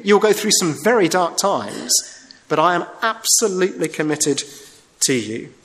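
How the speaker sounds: ordinary talk, nothing marked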